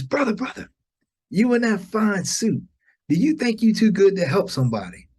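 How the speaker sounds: high-pitched mock yell of a street woman; imitating a yelling lady. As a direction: call out like she's heckling him on the street. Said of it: "lady yells".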